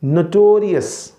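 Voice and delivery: नोटोरियस slowly, stressing the o after T; slow model नोटोरियस; o sound. Shown as a demonstration. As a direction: make the T give an o sound in नोटोरियस. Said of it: "वो ओ sound बने".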